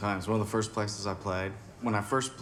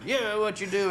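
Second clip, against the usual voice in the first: much higher, pushy challenge; challenging Greg; 11 semitones higher, 3 dB louder than usual. Retold or imitated. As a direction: goad him like a martial arts fan. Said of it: "he goes like".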